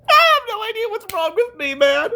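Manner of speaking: squeaky amused high pitch; giggly disbelief at yourself; giddy high voice. Real, not acted. Laughing at himself.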